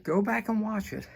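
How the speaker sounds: normal talking voice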